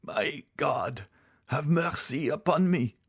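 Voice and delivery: high, wailing prayer for mercy; dying man's high-pitched cry; desperate plea. Acted. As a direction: beg in agony with your last strength. Dying man begs God for mercy.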